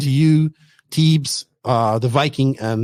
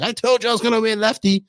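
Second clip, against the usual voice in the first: higher-pitched mock gloat; playful I-told-you-so jab; imagined gloating; 7 semitones higher than usual. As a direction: mimic a friend rubbing in a win. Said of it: "be like".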